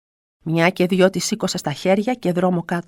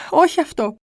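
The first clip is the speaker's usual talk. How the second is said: higher, pleading to the Virgin; pleading prayer; 5 semitones higher, 4 dB louder than usual. Retold or imitated. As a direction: beg for the child's life. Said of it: "έλεγα".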